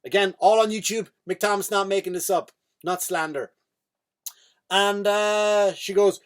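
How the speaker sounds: normal talking voice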